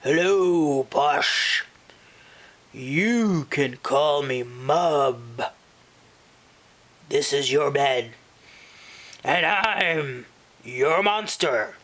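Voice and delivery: odd put-on monster voice, pitch kept even; friendly monster under the bed; sympathetic monster. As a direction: take her side in a character monster voice.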